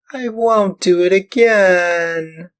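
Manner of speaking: high, loud, slow tearful plea; tearful promise. Acted. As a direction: promise through tears like a child. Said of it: "cried Pinocchio in tears".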